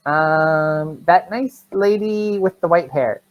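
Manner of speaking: put-on slow, high little-kid answer; small child. Child telling the barbarian who brought food, small child voice. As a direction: think it over in a high child voice.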